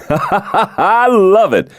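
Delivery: slow, high, laughing delight. Acted. The president laughs at Gabe's joke, words through laughter. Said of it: "The president laughed out loud".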